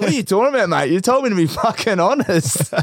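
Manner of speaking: high-pitched retold protest, near laughter; mock indignation. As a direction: imitate a mate protesting his innocence. Retold indignant reply to the coach.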